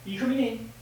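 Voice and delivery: soft, high invitation; coaxing pet talk. Real, not acted. To Filippo at the door, soft coaxing. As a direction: coax a pet in gently, high and quiet.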